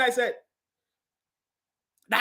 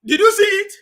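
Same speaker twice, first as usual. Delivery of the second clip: higher, louder exclamation; triumphant; 10 semitones higher, 9 dB louder than usual. Real, not acted. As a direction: point it out gleefully.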